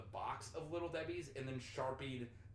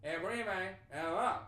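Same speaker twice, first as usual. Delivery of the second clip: loud West Virginian gibberish in separate chunks; mock West Virginian, loud and halting; broken-down unclear West Virginian; 6 semitones higher, 9 dB louder, much slower than usual. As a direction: break the garbled West Virginian into pieces, still unclear. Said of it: "breaks it down but doesn't talk clear".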